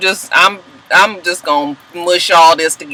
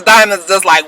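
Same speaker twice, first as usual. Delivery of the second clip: ordinary talk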